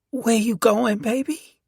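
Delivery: high-pitched woman's voice; voiced wife. A narrator's reading. Wife's line voiced by narrator.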